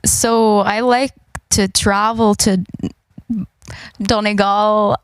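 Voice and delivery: put-on Northern Irish, leaning Donegal; Northern Irish accent. Player attempting Northern Ireland for the game, Donegal-sounding accent. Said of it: "it might sound a bit Donegal".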